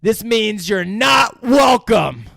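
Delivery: hammed-up shouted warning; mock angry shout. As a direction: yell it showing the tattoo. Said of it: "shows him his swastika and says".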